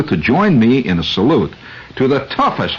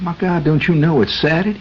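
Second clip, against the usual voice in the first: higher, shocked Southern voice; alarmed attendant; 8 semitones higher than usual. Retold or imitated. Attendant warning the driver about Saturday, in a shocked high voice. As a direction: warn him like a scared local. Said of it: "He said".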